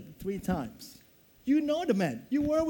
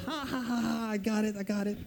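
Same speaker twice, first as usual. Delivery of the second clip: taunting; 5 dB quieter than usual. Pretend. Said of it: "Maybe he taunted all the rest".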